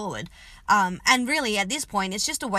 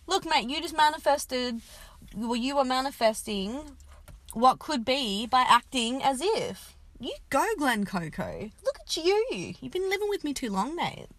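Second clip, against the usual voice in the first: talking to the dog; 4 semitones higher than usual. Real, not acted. Teasing the dog lovingly.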